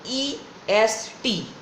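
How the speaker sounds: EST letter by letter, E, S, T, with gaps; slow, spaced-out letters in EST; spelled out slowly. Shown as a demonstration. Spells suffix so nobody mishears it.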